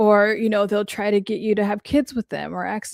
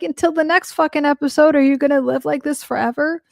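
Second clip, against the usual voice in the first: exasperated retort; 6 semitones higher than usual. Retold or imitated. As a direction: throw it back at them, exasperated. Speaker retells her exasperated retort.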